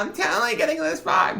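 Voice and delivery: put-on very high, upspeak valley girl. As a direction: squeal it like a sorority girl. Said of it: "I sound like a valley girl".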